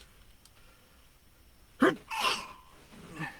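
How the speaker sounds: sharp rising pre-sneeze breath; real sneeze wind-up; building sneeze. Real, not acted. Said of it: "I wanna sneeze".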